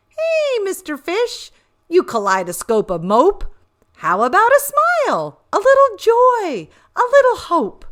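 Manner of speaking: high, fast, squeaky and cheeky; a high-pitched squid needling the gloomy fish; high teasing squid pestering Mister Fish; squirmy squid voice. Acted.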